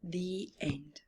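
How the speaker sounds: soft, whispered close; whispered the end; hushed story ending. A narrator's reading. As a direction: wind the tale down gently.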